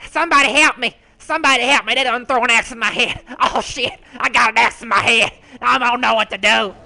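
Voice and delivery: high, loud frantic pleas for help; comic panicked yelling; retold panic. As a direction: wail for rescue, hammed up. Cries for help, axe in head.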